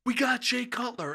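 high, thrilled mock cry; retold excitement. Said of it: "I got so excited".

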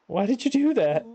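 high-pitched, incredulous question; exasperated. Acted. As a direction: scold a friend in disbelief. Friend touched the electric fence.

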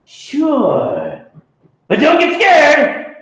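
high, loud monster warning. Acted. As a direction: warn the kid with playful menace. Second monster answers kid.

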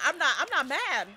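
usual speaking voice

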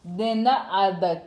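very slow, each syllable drawn out; slow careful repeat. Shown as a demonstration. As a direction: sound it out slowly after the teacher. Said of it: "let me say it slowly".

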